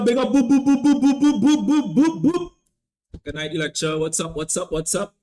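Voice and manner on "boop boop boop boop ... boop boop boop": rapid string of loud, high boops; celebratory boops. Pretend. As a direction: imitate a hype horn with repeated boops. Imitates noise for a shout-out.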